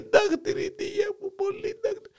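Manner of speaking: hammed-up high sob. Grandpa breaks down crying.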